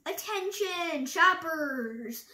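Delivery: high, slow, projected announcement; store announcement. A narrator's reading. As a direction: call out to the whole supermarket. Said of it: "she announced".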